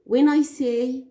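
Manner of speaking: usual speaking voice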